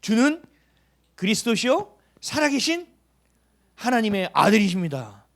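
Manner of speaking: rising, loud declaration of faith; Peter's confession. Retold or imitated. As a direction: proclaim it like an awed disciple. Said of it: "베드로가 얘기했죠".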